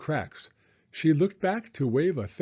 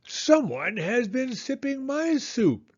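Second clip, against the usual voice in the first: much higher, slow mama bear voice with a mild growl; 11 semitones higher than usual. Acted. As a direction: growl it as a put-out mother bear. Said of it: "she growled".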